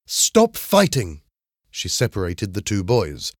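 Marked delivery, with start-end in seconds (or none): 0.1-1.2 high, slow, stern order; angrily. A narrator's reading. English teacher separates two fighting boys, angrily. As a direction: command it slowly with raised pitch. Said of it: "she said angrily".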